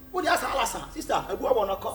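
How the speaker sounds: ordinary talk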